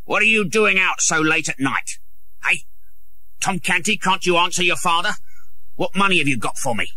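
gruff scolding father, demanding; rough father. A narrator's reading. John Canty scolding his son for money, gruff and demanding. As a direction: grab the boy and demand money.

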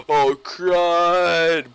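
startled exclamation. Real, not acted. Caught by the drop.

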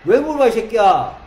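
shouting back, offended and loud; mock angry yell. Sumin snapping at Gitae, loud mock angry shout. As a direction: yell it like a friend losing his temper. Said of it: "기싸대기 날리겠지".

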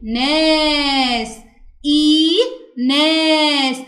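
"إيناس" very slowly, long "ee" and long "aa"; long vowels held. Shown as a demonstration. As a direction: stretch both vowels of "إيناس". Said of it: "زي الكلمة اللي معانا هنا". (مدّ الياء والألف في "إيناس")

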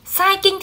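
"Sai kinh" lifted high to flag it as wrong; "Sai kinh" said high, teacher marking an error; quoting a mistake. Shown as a demonstration. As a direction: hold up "Sai kinh" with a high questioning pitch. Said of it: "thì chưa ok lắm nhá".

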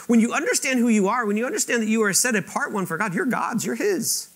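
high, loud, driving sermon voice; full-force preaching. Acted. Preacher urging the congregation, full-force preaching. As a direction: preach it out with rising fervour.

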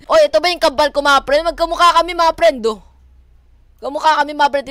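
high, loud amazement; excited discovery. Real, not acted. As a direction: call friends over to look. Delighted at lookalike game character.

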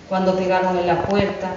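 plain reading voice

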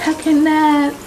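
high, squeaky cartoonish whine; exaggerated cartoon intonation. Exasperated about tired arm.

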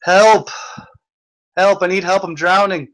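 high, loud, desperate shouting for help; panicked cry for help. Pretend. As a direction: yell like you're drowning. Skit of a drowning man.

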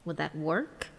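ordinary delivery, nothing marked

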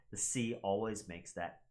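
normal talking voice

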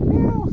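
high, short voiced meow to the cat; a quick little meow back at the kitty. Calling a cat with meows.

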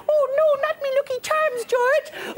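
high squeaky Irish leprechaun. Retold or imitated. Adele voiced in gossip story.

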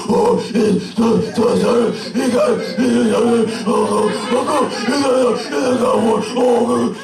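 put-on high, possessed child gasping in excitement; hammed-up demonic kid frenzy, words breaking up; little kid demon voice. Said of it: "that little kid demon voice".

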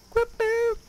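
high-pitched mimicked sound effect; quick high action sound; imitated shot noise. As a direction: squeak out the second shot's noise. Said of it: "and then I'm like".

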